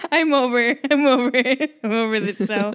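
laughing mock despair at the show; mock exasperation. Real, not acted. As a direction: throw up your hands in jest. Exasperated joke after name slip.

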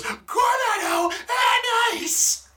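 high, slow, loud shouted line; mock shout. Obnoxious guy shouting in the theater, high slow shout. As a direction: belt it out obnoxiously. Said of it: "he goes".

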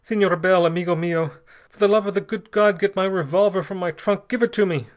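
high, loud, desperate begging; gasped plea. A narrator's reading. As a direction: plead urgently as if losing control. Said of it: "he gasped".

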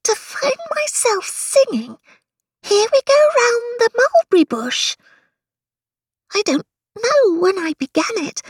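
Alice voice. A narrator's reading.

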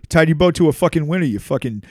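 loud, laughing, taunting insults; swearing tirade. Real, not acted. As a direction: taunt them with loud curses. Mock-addressing the betrayer and girlfriend.

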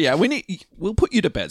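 put-on high-pitched parent fobbing off a child; mock soothing parents. Acting parents soothing their child.

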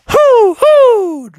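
high drawn-out hoots; high hooting owl impression; owl hooting back. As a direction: imitate an owl's answering call. The owl answers Bubby.

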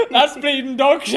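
laughing. Real, not acted. Laughing at the gross gravy.

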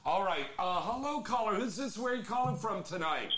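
hello-caller radio host voice; radio host greeting. Acted. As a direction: welcome the caller like a late-night DJ. Host opens a caller on air.

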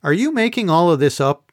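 usual speaking voice

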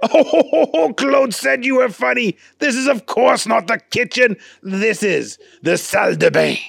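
laughing correction; lightly voiced chef; amused Gaston. A narrator's reading.

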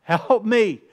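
pleading cry to the Lord; desperate cry for help. Retold or imitated. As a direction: call out for help like someone drowning. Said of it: "cry out to the Lord".

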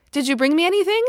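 put-on a child insisting on her treat; demanding child. A narrator's reading. Girl demanding a gift from her mother, high and loud. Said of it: "Kitty demanded".

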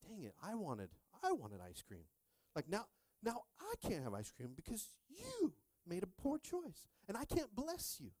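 mock complaint climbing high; disappointed dad. Dad to son who missed chores, hammed-up disappointment. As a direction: play up a thwarted dad's grievance, pitch rising sharply.

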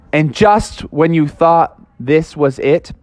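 ordinary delivery, nothing marked

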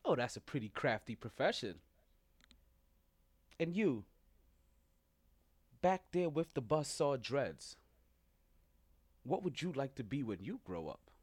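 low, quiet teacher voice; a nervous sub calling on kids; flustered substitute teacher. Acted. Teacher voice continues.

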